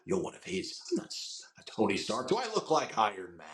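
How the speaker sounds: erratic villain, hushed then squeaking up; jumpy, half-whispered movie impression; Ulysses Klaue. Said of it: "Ulysses Klaue from Avengers two".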